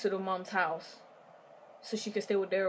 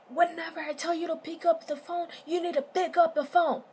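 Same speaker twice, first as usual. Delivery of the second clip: high, loud menacing demand; angry boyfriend; 8 semitones higher, 3 dB louder than usual. Retold or imitated. Boyfriend grabbing her arm, demanding she answer, furious. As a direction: bark the order at her. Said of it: "he grabbed her by the arm and he was like".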